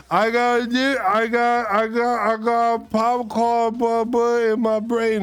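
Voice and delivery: put-on high stammering little-kid voice; retold kid answer. Answering her mother about the red nose, high childish stammer. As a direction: stutter it out like a guilty child. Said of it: "And I was like".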